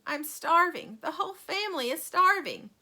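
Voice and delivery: high, quavery granny question; old woman voice. A narrator's reading. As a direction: ask like a hungry old granny. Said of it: "asked Grandma Josephine".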